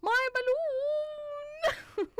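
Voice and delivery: very high, slow mock wail; wailing kid. Imagining a kid chased with balloon, hammed-up wail. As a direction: drag it out high, on the edge of laughter. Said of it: "I'm just imagining".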